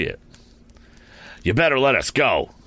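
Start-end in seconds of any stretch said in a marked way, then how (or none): 1.4-2.5 threatening. A narrator's reading. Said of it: "he threatened".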